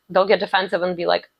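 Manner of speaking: ordinary talk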